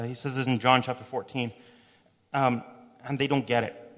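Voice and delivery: ordinary talk, nothing marked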